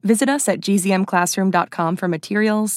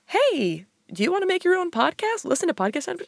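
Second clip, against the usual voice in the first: ad read voice; 10 semitones higher than usual. Acted. Acting out an ad read.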